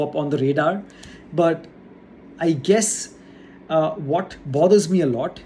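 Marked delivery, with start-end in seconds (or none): none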